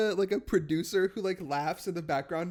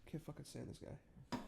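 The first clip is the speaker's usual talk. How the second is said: whispered aside; whispering; 19 dB quieter than usual. Real, not acted. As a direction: hiss it under your breath. Aside about the horse.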